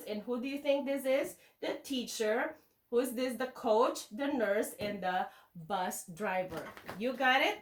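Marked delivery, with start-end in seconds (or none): none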